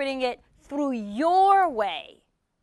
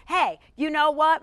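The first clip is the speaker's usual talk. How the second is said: ordinary talk, nothing marked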